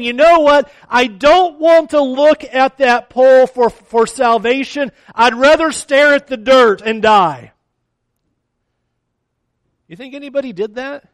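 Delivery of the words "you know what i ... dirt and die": a hint of a stubborn, defiant sinner, louder; stubborn refusal. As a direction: dig in your heels, mildly. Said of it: "anybody that stubborn that said".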